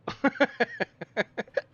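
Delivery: high, loud cackling laugh; sudden high laugh breaking through; burst of laughter. Real, not acted. Laughing at the flying cop.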